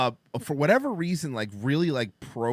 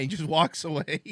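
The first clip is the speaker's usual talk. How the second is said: normal talking voice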